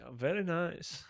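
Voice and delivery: slow, soft catchphrase impression; Borat. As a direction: quietly draw out a comic foreign accent. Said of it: "it's a Borat, German Borat".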